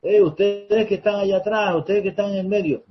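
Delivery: high, loud call to the back rows; calling out to the crowd, high and loud. Shown as a demonstration. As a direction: grab the room's attention, loud and high. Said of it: "Como cuando decimos".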